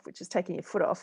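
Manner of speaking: ordinary talk, nothing marked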